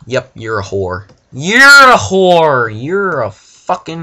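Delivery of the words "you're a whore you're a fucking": loud, high, slow shouted insult; yelled insult. Real, not acted. Cursing at a game character, loud shout. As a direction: yell it at the character.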